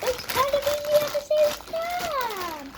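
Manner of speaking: high laughing voice. Real, not acted. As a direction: laugh through the words. Laughing line.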